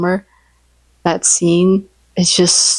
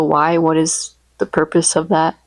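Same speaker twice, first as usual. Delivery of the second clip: normal talking voice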